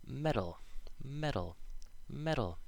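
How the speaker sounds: "metal" as "meddle", isolated and falling; tapped homophones. Shown as a demonstration. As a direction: say "metal" identically each time, tapping the T. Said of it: "sound the same".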